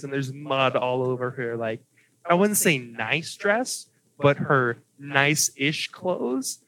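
ordinary delivery

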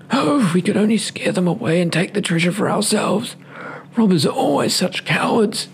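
high, excited storybook dog. Acted.

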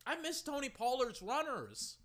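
ordinary delivery

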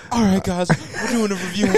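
happy reviewer. Retold or imitated. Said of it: "He was so happy when he first got in the car".

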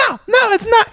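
very high falsetto refusal; mock irate manager. Manager rejects diner's attempt to calm things, mock irate falsetto. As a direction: imitate her cutting the peacemaker off. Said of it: "and she's like".

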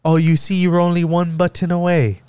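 high-pitched mock agent voice; put-on high helpline voice; imitated tech-support agent. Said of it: "he straight out was just like".

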